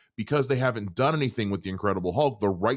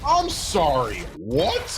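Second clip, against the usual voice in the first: raised-pitch baffled question; incredulous; 4 semitones higher, 3 dB louder, much slower than usual. Real, not acted. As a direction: react in real disbelief. Baffled reaction to trailer.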